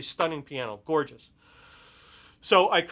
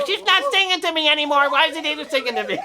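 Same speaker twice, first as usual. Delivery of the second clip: lightly voiced little girl, higher, quick at the end; mock child complaint; 11 semitones higher, 6 dB louder, faster than usual. [name] complains to Grandma in a mock little-girl whine. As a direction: whine it like a kid tattling. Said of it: "she doesn't have to say to Grandma".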